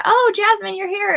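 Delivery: real, high-pitched happy surprise; delighted surprise. Real, not acted. Spots a returning viewer joining.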